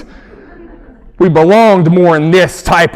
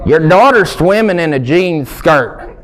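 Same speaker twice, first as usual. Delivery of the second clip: ordinary talk, nothing marked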